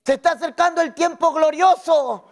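preaching at full force to the congregation; full-voiced sermon, every phrase pushed out; fiery preaching. (predicando a toda voz ante la iglesia)

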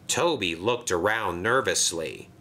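ordinary delivery

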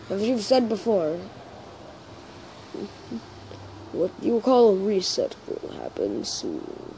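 subdued character voice, halting with long pauses; lightly put-on, measured storyteller; Grillby impression. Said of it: "I'm gonna do Grillby".

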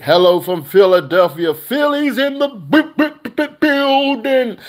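high, loud hammed-up stuttering cheer; mock Philly fan. Vlogger mocking a Philadelphia fan's comment, very high and loud. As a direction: mimic the fan bragging.

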